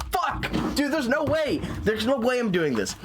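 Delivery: freaking out as it catches up; being chased. Real, not acted.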